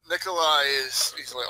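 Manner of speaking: ordinary delivery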